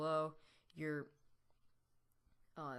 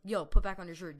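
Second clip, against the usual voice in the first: much louder retold call; 13 dB louder than usual. Friends yelling at a shirtless friend running down the block, calling out. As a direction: shout it after a friend.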